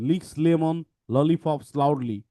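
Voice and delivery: slow, deliberate, every L sounded; slow tongue twister. Shown as a demonstration. Teacher modelling the tongue twister for students, slowly. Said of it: "আমরা একটু দেখি".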